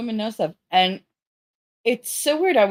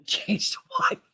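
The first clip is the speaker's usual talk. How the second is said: whispered aside; 9 semitones higher, 4 dB quieter than usual. Real, not acted.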